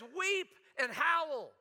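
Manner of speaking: loud, high, slow weep and howl; prophet's cry. Retold or imitated. Preacher voicing James crying to the rich, loud and slow. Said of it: "he's just a preaching".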